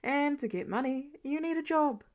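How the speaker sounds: plain reading voice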